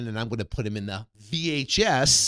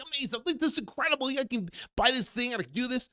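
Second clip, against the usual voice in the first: much higher, gushing excitement; excited; 11 semitones higher, faster than usual. Retold or imitated. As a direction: gush to your father-in-law, voice way up. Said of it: "I was all excited".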